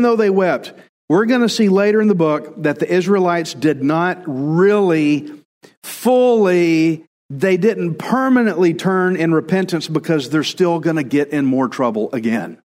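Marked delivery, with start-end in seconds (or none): none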